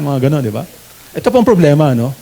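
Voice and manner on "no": usual speaking voice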